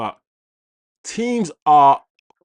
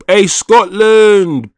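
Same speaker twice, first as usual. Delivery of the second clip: loud, high, drawn-out hail; hearty shout-out; 7 semitones higher, 10 dB louder, much slower than usual. Real, not acted. Host greeting a viewer from Scotland, hearty shout-out. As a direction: call it out big and slow.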